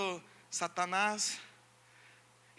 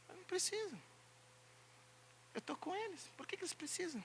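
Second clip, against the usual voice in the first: soft reply; 7 semitones higher, 15 dB quieter, faster than usual. Acted. Jesus voiced answering.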